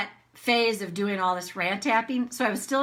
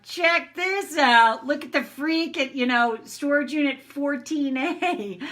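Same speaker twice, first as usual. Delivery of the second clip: higher, drawn-out mocking guard; a security guard higher and slow, pointing out the freak; 5 semitones higher, slower than usual. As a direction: tease the freak on camera.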